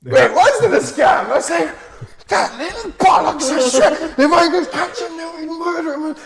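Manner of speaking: hammed-up fury at the little scammer; mock anger. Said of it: "I'm getting all angry".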